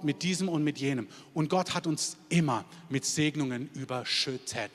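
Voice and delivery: normal talking voice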